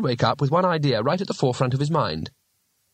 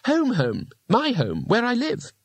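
sheepish; 11 semitones higher, slower than usual. A narrator's reading. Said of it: "Two Flower explained sheepishly".

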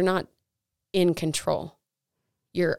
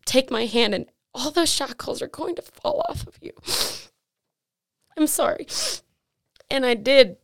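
crying for real, high and louder; tearful; 8 semitones higher, 4 dB louder than usual. Real, not acted. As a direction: push the words out through tears. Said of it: "I'm getting emotional. I hate crying".

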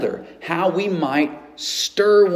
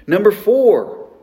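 announcing; 5 semitones higher, 6 dB louder, slower than usual. Real, not acted. Announcing the next sermon point.

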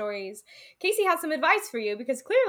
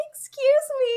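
put-on little old lady; 12 semitones higher, 3 dB louder than usual. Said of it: "this little old lady's like".

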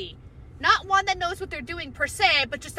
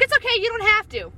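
mock reassuring boss; 7 semitones higher, 3 dB louder than usual. Imitating chief engineer.

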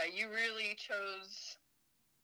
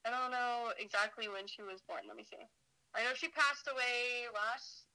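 usual speaking voice

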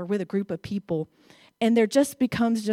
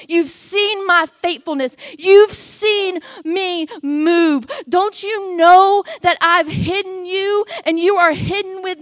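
building plea; 8 semitones higher, 10 dB louder than usual. Acted. God pleads with Elijah.

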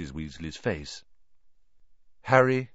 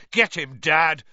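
higher, louder cheering shout; a twin egging his father into the fight; yell; 8 semitones higher, 6 dB louder than usual. Acted. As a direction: urge your dad on with a yell. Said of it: "There was a yell of".

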